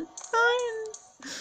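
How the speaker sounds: very high, long wailing "Nein!"; desperate scream. Real, not acted. Gamer wails as her character is cornered, desperate scream. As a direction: wail in despair. About to die in game.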